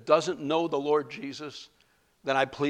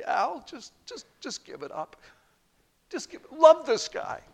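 1 God pleading; 11 semitones higher than usual. Retold or imitated. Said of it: "God was pleading with me".

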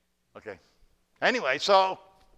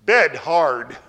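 grumpy monk; 3 semitones higher, 12 dB louder than usual. Retold or imitated. Monk's two words a year.

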